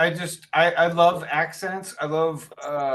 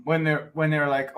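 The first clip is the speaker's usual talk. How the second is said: normal talking voice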